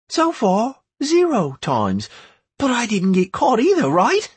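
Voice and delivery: put-on high boyish voice; boy talking eagerly; young boy voice. A narrator's reading.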